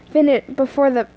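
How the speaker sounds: plain reading voice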